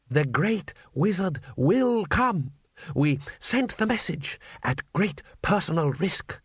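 slow, grave rebel leader; earnest revolutionary leader. A narrator's reading. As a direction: announce it like secret good news to comrades. Two Fire Herb briefs the cadre.

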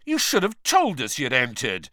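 mild, understated bellow; a contained mock bellow. A narrator's reading. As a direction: call it out as a half-annoyed accusation. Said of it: "bellowed Fred".

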